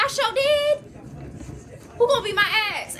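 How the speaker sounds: mock cheeky kid talking back; cocky boy. Little boy brazenly answers his dad, cocky.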